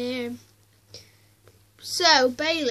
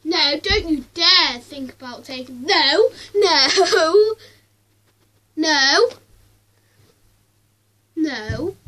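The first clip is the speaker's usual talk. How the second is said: loud warning; 8 dB louder, slower than usual. Real, not acted. Dog going for the treat.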